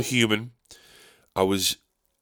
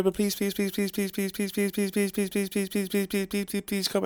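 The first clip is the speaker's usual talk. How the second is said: high, soft, repeated begging; pleading; 10 semitones higher, 7 dB quieter than usual. Acted. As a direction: beg them over and over. Begging fans to come.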